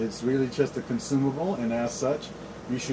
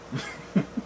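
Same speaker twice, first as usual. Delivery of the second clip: quiet unvoiced chuckle; soft breathy laugh; 3 dB quieter than usual. Real, not acted. Laughs at own joke.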